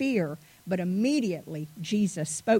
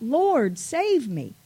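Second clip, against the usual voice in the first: higher, louder cry for help; 5 semitones higher, 5 dB louder, slower than usual. A narrator's reading. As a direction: cry out as if sinking, in a reader's measured way. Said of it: "He cried out".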